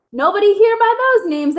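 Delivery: a hint of a high clucking hen; lightly voiced high hen reply. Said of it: "a hen clucked".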